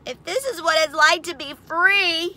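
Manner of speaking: high, loud upset piglet voice; high-pitched pig complaint; distressed young pig. A narrator's reading. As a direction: fret like a hounded little pig. Overwhelmed pig thinks, being chased.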